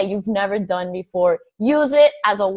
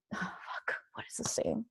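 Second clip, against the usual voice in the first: whispered aside; 12 semitones lower, 22 dB quieter than usual. Real, not acted. Forgets the quote, mutters aside.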